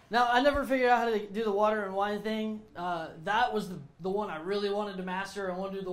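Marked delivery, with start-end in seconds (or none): none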